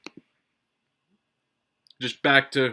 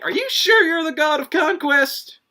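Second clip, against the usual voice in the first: much higher, louder incredulous question; mock disbelief; 7 dB louder than usual. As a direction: squeal it in disbelief at his looks. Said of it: "And I'm like".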